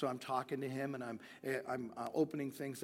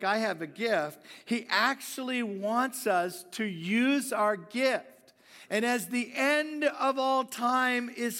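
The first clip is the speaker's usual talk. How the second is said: higher, louder, slow preaching build; preaching at full force; 6 semitones higher, 8 dB louder than usual. Acted. Preacher urges the church to use their gifts, in full-force preaching. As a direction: preach it out to the whole room.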